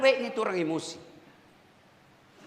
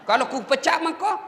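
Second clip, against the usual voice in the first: usual speaking voice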